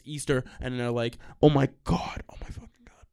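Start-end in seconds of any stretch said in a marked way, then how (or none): 1.4-2.2 stretched, emphatic outcry; acted exclamation. Acting out a craving reaction. 2.3-3.0 very soft, voiceless whisper; hushed, overcome aside; awed whisper. Retold or imitated. Acting out a craving reaction.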